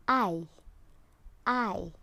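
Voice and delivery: I drawn out with the jaw dropped; long I sound. Shown as a demonstration. Teacher showing pupils the long I sound. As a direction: open wide on I. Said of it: "So it has like this".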